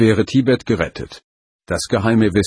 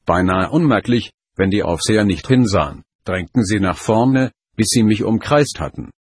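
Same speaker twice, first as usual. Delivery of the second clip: plain reading voice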